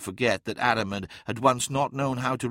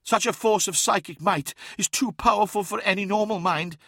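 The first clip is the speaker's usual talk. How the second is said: high, strained-sounding character voice; reliving painful memory; 9 semitones higher than usual. A narrator's reading. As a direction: speak high, as if the memory still hurts. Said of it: "reliving a painful memory".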